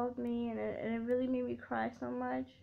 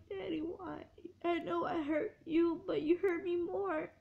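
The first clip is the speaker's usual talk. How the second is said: retold tearful plea; 5 semitones higher than usual. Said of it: "I'm like".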